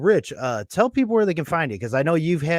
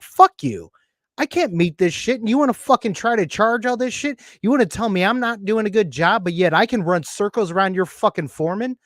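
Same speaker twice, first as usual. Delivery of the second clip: retold angry outburst, pitched up after the first blast; indignant rant; 6 semitones higher than usual. Machinist telling off the union bosses, indignant.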